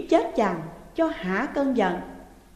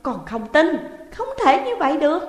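much higher, louder and fast denial; shouting; 6 semitones higher, 6 dB louder than usual. Acted. Daughter shouting disbelief at her mother, loud and fast. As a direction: scream it cannot be so. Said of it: "Dung hét lớn".

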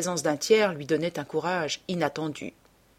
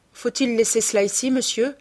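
deferential servant; 6 semitones higher, 3 dB louder than usual. A narrator's reading. Servant asks his master.

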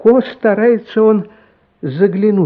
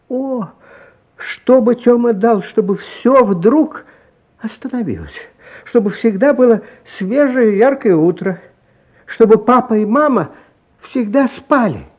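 longing story narration, lifted; dreamy narration; 6 semitones higher, 3 dB louder than usual. A narrator's reading. Narrator telling the boy's wish, wistful.

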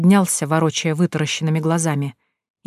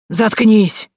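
sharp loud higher shout; 5 semitones higher, 5 dB louder than usual. A narrator's reading. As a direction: bark it at him. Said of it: "прикрикнула на него ведьма".

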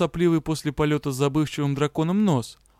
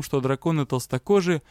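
ordinary delivery

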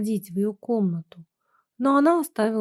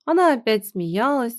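plain reading voice

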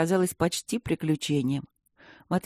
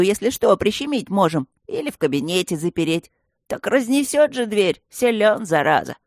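loud, higher rough creature voice; gargoyle voice; 5 semitones higher, 5 dB louder than usual. A narrator's reading. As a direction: coax her, then boast. Gargoyle coaxing and boasting.

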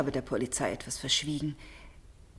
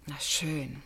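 whisper; 4 semitones lower, 9 dB quieter than usual. Acted. Reluctant on the phone.

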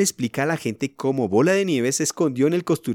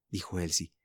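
ordinary delivery

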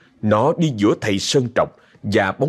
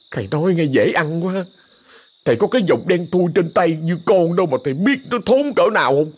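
put-on higher, complaining young servant voice; whiny young helper; 8 semitones higher than usual. Scared helper complains to master, higher whiny voice. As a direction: whine back at the master, aggrieved.

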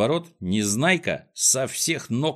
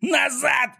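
very high, sudden yell; urgent high-pitched shout; yelled order. A narrator's reading. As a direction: scream to call everyone back. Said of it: "заорал он".

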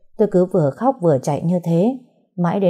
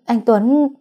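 rising panic; 6 semitones higher, 3 dB louder than usual. Acted. Panicked wife calls husband's name.

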